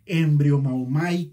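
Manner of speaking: embriomaumai spelled out slowly and loud; careful slow Greek embriomaumai; Greek word slowly. Shown as a demonstration. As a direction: lay out embriomaumai syllable by syllable. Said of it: "en el original griego es".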